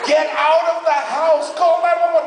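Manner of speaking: frantic high-pitched command; panicked shouting. Acted. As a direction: yell urgent orders down the phone. Panicked reply to burning-house call.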